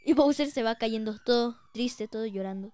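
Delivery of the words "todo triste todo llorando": ordinary delivery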